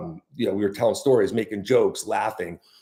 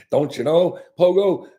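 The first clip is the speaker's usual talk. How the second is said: slow, sing-song Wisconsin "don't you know"; a hint of a Wisconsin local, rising at the end; Wisconsin accent; 9 semitones higher, 6 dB louder, slower than usual. Pogo doing the Wisconsin phrase for his listeners, in a Wisconsin accent. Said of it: "as they say in Wisconsin".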